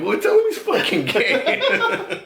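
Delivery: very high, loud, stretched-out comeback; laughing retort. Retold or imitated. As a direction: squeal the retold retort through laughter. Retells his heated comeback, laughing.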